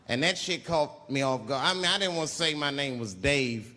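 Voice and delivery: ordinary talk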